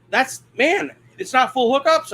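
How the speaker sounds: high, disbelieving retold question; incredulous question. Camper questioning the check-in guy, high and incredulous. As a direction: pitch it way up in baffled disbelief. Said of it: "I said it just like that".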